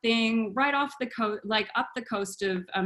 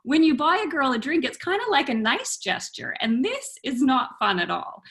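higher, emphatic mock scolding; indignant lecture, acted out; retold telling-off; 6 semitones higher than usual. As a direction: set him straight, stressing key words.

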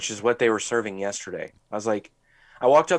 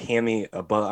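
ordinary talk

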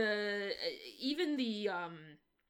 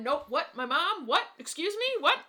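rising, louder mock disbelief; startled questions climbing higher; incredulous double-take; 7 semitones higher, 7 dB louder than usual. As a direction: snap out of it in acted shock. Acting out character's sudden double-take.

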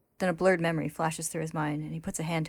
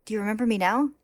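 eager; 5 semitones higher, 5 dB louder than usual. A narrator's reading. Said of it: "Beto smiles eagerly".

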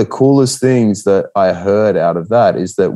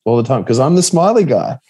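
higher, forced-cheerful voice of the smiley guy; 7 semitones higher than usual. Retold or imitated. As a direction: chirp it like you must always smile. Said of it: "I was like".

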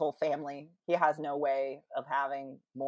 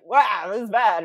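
mock dismay; 3 semitones higher, 10 dB louder than usual. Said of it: "He's like".

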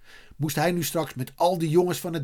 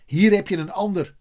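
raised, louder offer; calling out; 5 semitones higher, 5 dB louder than usual. A narrator's reading. Terrier throws Eddy a spare lace, calling. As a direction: call it across the room. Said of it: "riep de terrier".

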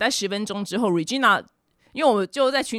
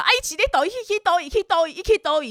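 much higher, insistent grandma repeating herself; imitating grandma who repeats it a thousand times; endlessly repeating grandma; 10 semitones higher, faster than usual. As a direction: say it over and over like a grandma who won't let go. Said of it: "就一千次这样".

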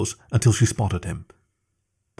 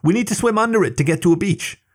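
still yelling; 10 semitones higher than usual. A narrator's reading. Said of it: "she yelled".

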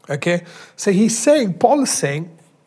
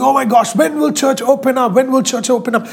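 higher-pitched mock fretting; mock impatience; 6 semitones higher than usual. Pastor mimicking people waiting for church to reopen, mock impatient. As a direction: fret in an imitated impatient voice.

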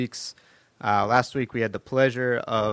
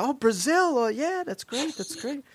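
much higher, gushing voice; clueless enthusiasm. Retold or imitated. Stranger mishearing PSG as Brazil, high gushing voice. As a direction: gush like a clueless fan. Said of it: "he's like".

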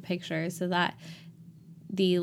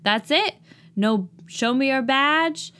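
loud, higher-pitched sassy challenge; Reese impression; 6 semitones higher, 4 dB louder than usual. Host mimics campus security guard Reese, impression. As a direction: challenge them in a high, loud, drawn-out voice. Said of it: "Your Reese impersonation is spot on".

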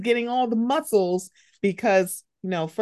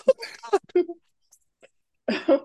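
mock sob; 8 semitones higher than usual. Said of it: "snot bubble crying".

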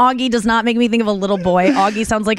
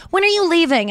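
put-on high, pushy little-boy voice; lightly voiced kid nagging, pitched high; impatient nephew; 9 semitones higher than usual. Nephew nagging his aunt to leave, in a high kid voice. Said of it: "he kept being like".

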